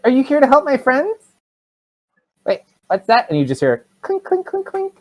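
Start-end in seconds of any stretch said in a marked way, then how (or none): 0.0-3.3 put-on high, questioning kid voice; small child. Child asking a newcomer to help friends, high child voice. 4.0-4.6 high, repeated metallic clink noises; imitated clinking. Game master showing what the players hear, imitated clinks. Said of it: "hear, like, this weird sound".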